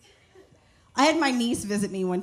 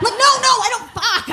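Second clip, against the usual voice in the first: much higher and louder panicked denial; panicked yelp; 12 semitones higher, 11 dB louder than usual. Retold or imitated. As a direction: cry out in sudden panic. Panicked denial about explosives.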